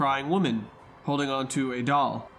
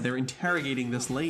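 normal talking voice

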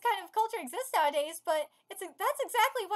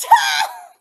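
high, shouted burst of laughter; real high-pitched laugh; loud cackle; 10 semitones higher, 14 dB louder than usual. Real, not acted. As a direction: explode into a short shrieking laugh. Laughs at the story's jab.

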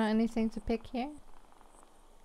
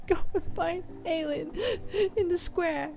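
high-pitched tearful voice, broken by sobbing laughs; happy crying that won't stop, apologising through it; [name] crying; 9 semitones higher than usual. Acted. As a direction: speak much higher, overcome and weepy. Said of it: "I don't know why I'm crying".

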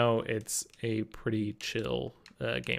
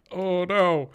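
mock dismay; 7 semitones higher, 7 dB louder than usual. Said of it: "I was like".

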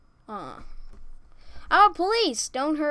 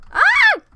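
very high startled scream; shrill scream; 13 dB louder than usual. Real, not acted. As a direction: scream in fright. Sudden scare.

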